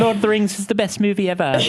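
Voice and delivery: high excited mock Kiwi voice; mock New Zealander. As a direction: play up the fan. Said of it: "that's all you needed to say".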